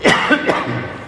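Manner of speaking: hoarse cough from emotion; throat-clearing cough. Real, not acted. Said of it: "se me pone la voz así un poco ronca". (tos ronca por la emoción)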